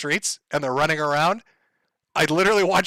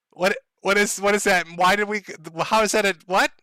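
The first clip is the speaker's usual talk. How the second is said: put-on higher, bewildered child voice with giggles; confused kid; 4 semitones higher than usual. Timmy asking the teacher what the word means, higher and baffled. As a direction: play a baffled schoolboy, stumbling over questions.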